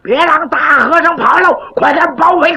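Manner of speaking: 提高嗓门吆喝包围; 像一群兵丁在外头嚷; 军兵喊叫. Acted. 外面军兵叫喊. (官兵高声吆喝)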